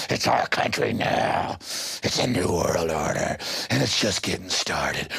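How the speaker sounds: low, slow menacing gloat; mock tyrant voice. Mocking voice of the enforcers.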